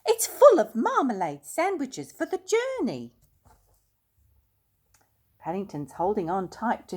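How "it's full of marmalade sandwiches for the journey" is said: high, loud and urgent; eager bear protest. A narrator's reading. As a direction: plead to keep the suitcase. Paddington protests about his case.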